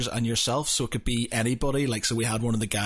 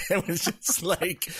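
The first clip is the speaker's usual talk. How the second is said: laughing; 7 semitones higher, slower than usual. Real, not acted. Laughs at own story.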